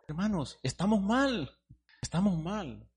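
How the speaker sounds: loud, high preaching cry; preaching at full force. Acted. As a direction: lament it to the whole church. Preacher exhorting congregation.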